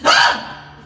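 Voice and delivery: loud horrified gasp; sudden mock-horror gasp; shocked gasp. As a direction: gasp as if told of your doom. Oak hears it will be cut.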